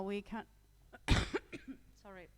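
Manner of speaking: ordinary talk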